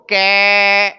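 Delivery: कै held level as a stretched "kaiii"; wrong vowel demo. Shown as a demonstration. Teacher showing students how not to pronounce the letter, stretched wrong vowel. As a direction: draw कै out long and loud with an open "ai" vowel. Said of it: "ऐसे नहीं किया जाएगा".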